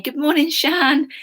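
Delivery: teacher greeting a student who just joined; cheery greeting. Real, not acted. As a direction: sing out a welcome to the student.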